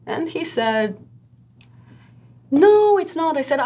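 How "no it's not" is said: much higher, louder denial; mock client denial. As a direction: toss off the client's protest. Client voiced on phone.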